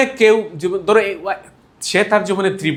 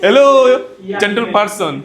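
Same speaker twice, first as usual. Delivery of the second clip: loud, high British Hello; British accent; British greeting; 3 semitones higher, 4 dB louder, much slower than usual. Shown as a demonstration. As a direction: greet him like a British gentleman. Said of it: "বলতে হবে".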